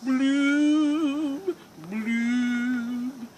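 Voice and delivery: loud, slow, gloomy fish with a long sighing bloom; glum pout pout fish. Acted. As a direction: mope it out, then let the blooms sink and fade. Reads the glum fish's refrain.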